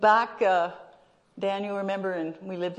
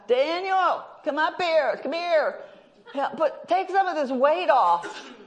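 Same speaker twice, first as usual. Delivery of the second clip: calling for help; 10 semitones higher than usual. Retold or imitated. Calling son for help at gym.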